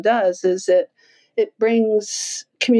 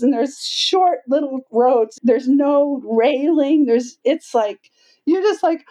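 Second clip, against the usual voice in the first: ordinary delivery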